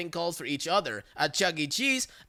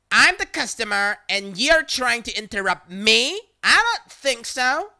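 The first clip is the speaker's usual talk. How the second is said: entitled outrage; outraged customer; 5 semitones higher, 6 dB louder than usual. Retold or imitated. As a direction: caller refusing to be interrupted. Caller outraged.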